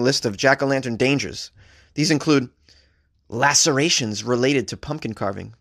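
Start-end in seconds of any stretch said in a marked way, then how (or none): none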